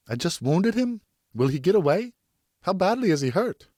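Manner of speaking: put-on a frightened boy near tears; anxious boy. A narrator's reading. Tearful Geoffroy pleading with Pear about the wounded stag, high and anxious.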